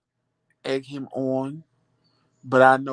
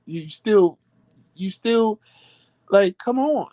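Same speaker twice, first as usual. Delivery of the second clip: high, exasperated come on; rising exasperation; 7 semitones higher, slower than usual. Real, not acted. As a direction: plead in disbelief.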